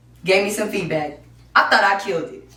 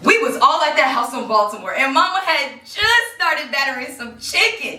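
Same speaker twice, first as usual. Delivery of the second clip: raised, lively character storytelling voice; monologue as Marie; 6 semitones higher than usual. Acted. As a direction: perform the audition piece in full character. Performs audition monologue as Marie.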